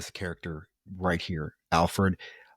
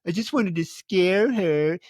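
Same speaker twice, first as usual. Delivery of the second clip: mocking whiny teen; 6 semitones higher than usual. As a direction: mimic a self-pitying creep, dragging it out. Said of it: "He's like".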